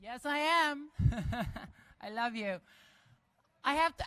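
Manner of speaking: ordinary delivery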